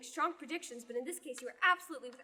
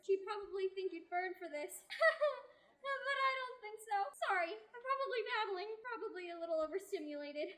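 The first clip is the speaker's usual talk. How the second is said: bubbly sheltered nun babbling, high and eager; excited young nun; 7 semitones higher than usual. Acted. Young nun chattering to new companions, bubbly. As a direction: gush like a girl let out of the nunnery.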